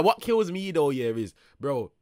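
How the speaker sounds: ordinary delivery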